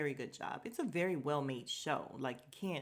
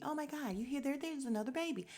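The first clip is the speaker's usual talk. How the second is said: excited mom; 8 semitones higher than usual. Retold or imitated. June voicing excitement over baby.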